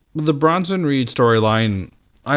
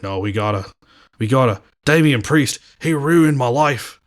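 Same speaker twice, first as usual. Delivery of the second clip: hammed-up Aussie whine; bad Australian accent. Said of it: "That's a bad Australian accent".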